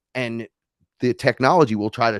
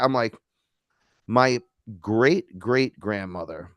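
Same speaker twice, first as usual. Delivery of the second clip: normal talking voice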